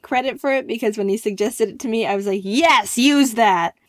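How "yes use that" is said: loud, keen yes; eager approval. Retold or imitated. Telling the site owner to use his slogan, loud and eager. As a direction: shout your approval happily.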